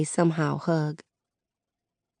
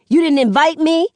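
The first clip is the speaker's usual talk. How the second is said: higher, loud sarcastic repeat of her words; mocking echo; 11 semitones higher, 6 dB louder than usual. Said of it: "he mocks".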